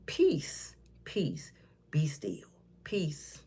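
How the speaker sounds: whispered, slow preacher calm; hushed blessing. Pretend. Calming the storm with a Bible, slow whispered prayer.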